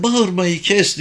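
put-on high-pitched child, mildly tearful; sobbing child. A narrator's reading. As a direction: protest as an upset little girl. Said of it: "diye hıçkırdı".

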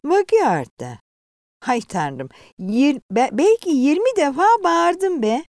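loud, exasperated conductor; grumpy reply. A narrator's reading. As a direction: snap back, fed up with repeating. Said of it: "keyifsiz bir tavırla karşılık verdi".

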